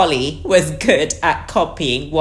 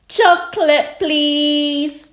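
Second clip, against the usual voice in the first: put-on high, drawn-out young sheep voice; Susie Sheep; 12 semitones higher, 5 dB louder, slower than usual. A narrator's reading. Susie Sheep orders from the boat, high-voiced. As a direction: ask sweetly in a raised child's pitch. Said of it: "Susie Sheep said".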